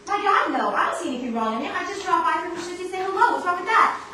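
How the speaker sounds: loud, high, rattled protest; flustered defensiveness. Acted. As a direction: protest too much, rattled. Visitor getting defensive.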